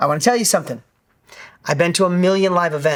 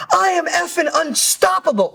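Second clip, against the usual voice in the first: much higher, bursting with elation; ecstatic shout; 10 semitones higher than usual. Retold or imitated. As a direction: crow it like he's unstoppable. Buddy overjoyed at the event.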